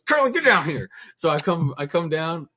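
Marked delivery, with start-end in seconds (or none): 0.0-0.9 calling someone down. Retold or imitated. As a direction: imitate a boss's loud, high summons. Boss calls him down, retold.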